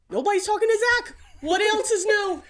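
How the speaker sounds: high, fast mocking squawk of a fed-up mom; hammed-up high-pitched scolding. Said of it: "She goes".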